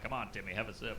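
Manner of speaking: soft, sly coaxing, a touch creepy. Retold or imitated. Uncle coaxing a kid to drink, soft creepy. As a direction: coax the kid quietly like a creep. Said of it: "I know I sound like a pedophile".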